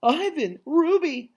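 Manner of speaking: high, bright delighted calls. Acted. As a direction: greet them with joy. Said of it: "in a delighted voice".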